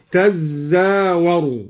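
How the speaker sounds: تزاور said "tazzawaru"; doubled zay. Shown as a demonstration. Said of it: "قرأ الدوري بالتشديد".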